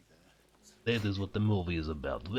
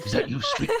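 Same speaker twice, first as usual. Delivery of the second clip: mock alarmed cry; 4 dB louder, faster than usual. Mock calling out to Elmo.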